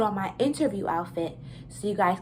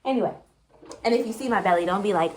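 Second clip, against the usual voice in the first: ordinary talk, nothing marked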